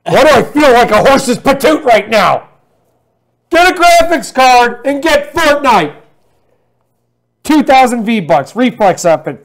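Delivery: loud, high promo yelling; big mock-enthusiastic ad holler; hammed-up hype-man shout. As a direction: belt out the deal like an over-the-top pitchman. Hammed-up shouted promo after laughter.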